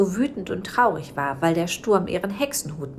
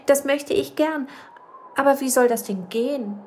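higher, louder, eager cry; excited little witch; 8 semitones higher, 3 dB louder than usual. Acted. Little witch eagerly accepting the ghost's help, high and loud. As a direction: burst out with delight. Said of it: "rief die kleine Hexe begeistert".

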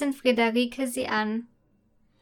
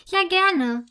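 higher, louder happy reply; maid's daughter calling back to the princess; eager call back; 6 semitones higher, 4 dB louder than usual. Acted. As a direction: call back, already running inside. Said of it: "ruft Fia zurück und läuft aufgeregt".

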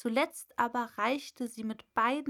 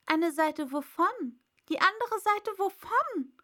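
high, louder puzzled question; puzzled Alice; 8 semitones higher, 4 dB louder than usual. Acted. Alice baffled by the caterpillar's clue, high and puzzled. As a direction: wonder aloud, baffled.

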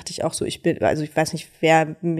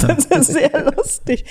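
laughing through the words, higher and drawn out; amused laughing; 8 semitones higher, slower than usual. Real, not acted. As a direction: say it through a real chuckle. Amused by horror fans' messages. (amüsiert lachend)

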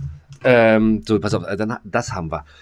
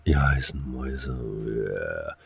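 slow, low, drawn-out come-on to the listeners; mock seductive; 8 semitones lower, 6 dB quieter, much slower than usual. Host flirting with the female listeners, slow and low. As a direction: purr it out slowly and low, hamming it up.

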